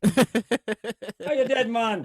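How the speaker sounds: mock Jamaican movie line; Jamaican accent. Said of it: "Speaking of Jamaican, uh, Cool Runnings".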